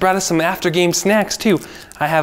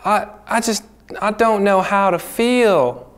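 sad and angry; 6 semitones higher, slower than usual. Acted. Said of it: "I'm so sad and kinda angry".

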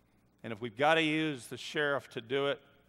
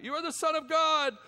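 much higher and louder, a shrill demon cry; evil spirit crying out at Jesus, high and loud; 13 semitones higher, 6 dB louder than usual. A narrator's reading. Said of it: "cried out".

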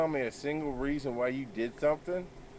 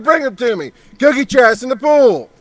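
much higher, very loud drill-sergeant yell; real yelling; 11 semitones higher, 14 dB louder than usual. Real, not acted. Yelling at kids in the pool.

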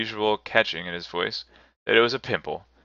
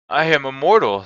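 loud, raised protest; remonstrating; 6 semitones higher, 7 dB louder than usual. A narrator's reading. Scrooge protests to the spirit, loudly remonstrating. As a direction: plead against being led out. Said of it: "Scrooge remonstrated".

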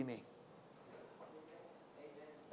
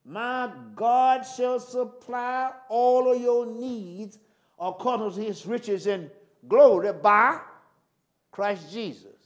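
very slow "My God shall supply", each word stretched; unhurried preaching recitation; slowly; 4 dB louder, slower than usual. Shown as a demonstration. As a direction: read the scripture slow and deliberate. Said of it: "don't say it too fast".

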